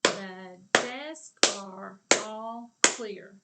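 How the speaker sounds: each word called loud and slow; slow loud clapping chant. Shown as a demonstration. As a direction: clap out every word loudly. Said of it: "Clap it with me".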